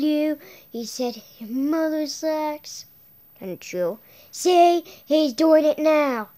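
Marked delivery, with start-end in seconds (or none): none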